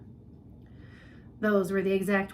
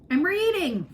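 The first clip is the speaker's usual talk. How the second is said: high, loud reply; girl shouting down to her mother; shouting back; 10 semitones higher, 7 dB louder than usual. A narrator's reading. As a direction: yell back down the stairs. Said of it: "Flora shouted back".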